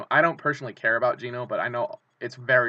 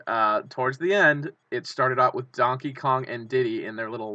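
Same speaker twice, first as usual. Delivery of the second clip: ordinary talk, nothing marked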